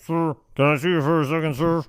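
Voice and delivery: mouth-made muffled announcement; garbled call-over. Retold or imitated. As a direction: rattle it off like a distorted speaker. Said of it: "don't even know what the hell he says".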